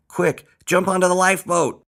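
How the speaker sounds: high, loud captain's order; high-pitched urgent yell; urgent shout. A narrator's reading. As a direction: shout an emergency command. Earl orders abandoning ship.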